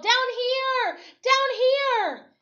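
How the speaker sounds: high, loud call to the plane above; yelling. A narrator's reading. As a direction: shout up at the plane, desperate to be seen. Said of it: "I yelled".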